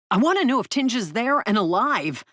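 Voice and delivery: loud, frantic demand; shouting. Acted. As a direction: shout it at the phone. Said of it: "I was shouting at the phone".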